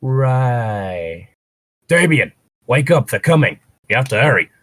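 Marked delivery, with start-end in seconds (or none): none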